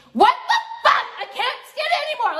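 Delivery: can't-believe-it squeal that dissolves into a laugh; exasperated shriek. Real, not acted. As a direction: blurt it out high, half laughing in disbelief. Exasperated outburst at commenters.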